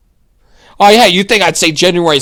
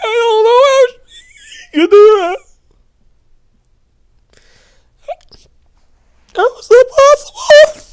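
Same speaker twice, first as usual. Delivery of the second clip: much higher, put-on incredulous voice; mock disbelief. Host mocking outrage over the reporter with fake shock.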